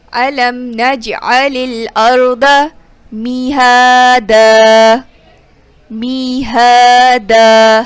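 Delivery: مہادا with the ha vowel held long; stretched alif madd. Shown as a demonstration. Teacher demonstrating the alif madd in مہادا, held long. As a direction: draw out the ا after ہ in مہادا, slow chant. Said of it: "ہاں کو اس لیے کھینچا".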